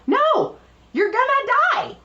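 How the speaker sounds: very high, exclaimed warning; high-pitched mock alarm. Retelling a warning to the painter about fumes, high mock alarm. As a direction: squeal each warning in exaggerated alarm.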